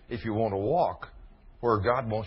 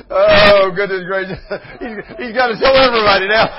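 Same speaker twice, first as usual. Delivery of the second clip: loud, high words through laughter; loud, laughing exclamation; 8 semitones higher, 14 dB louder than usual. Real, not acted. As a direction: speak while still laughing hard. Still laughing hard.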